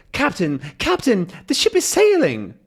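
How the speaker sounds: put-on high, excited old professor calling out; Calculus voice. Calculus announcing to the captain, high and excited. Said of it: "And he says".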